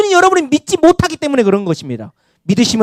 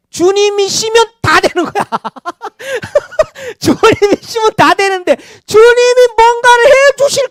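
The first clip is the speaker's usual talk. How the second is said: laughing exclamation; 8 semitones higher than usual. Real, not acted. Preacher to congregation on wanting only blessings, laughing.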